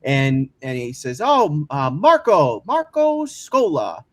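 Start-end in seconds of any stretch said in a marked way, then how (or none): 1.2-4.0 mock-serious, teasing older radio host; lightly voiced Harry ribbing the new intern; teasing Harry impression. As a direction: needle the kid, drawing out his name. Imitating Harry teasing him.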